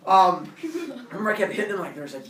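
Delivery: usual speaking voice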